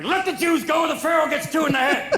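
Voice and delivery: Charlton Heston. Acted. Comic mocking a gun-loving actor as Moses, mock shout. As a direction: bellow it like a tough gun advocate. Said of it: "not Charlton Heston going".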